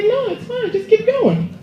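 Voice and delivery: put-on high, small girl's voice; little girl voice. Comic replaying the girl's reply to the babysitter, high girlish voice. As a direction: squeak it out like a kid.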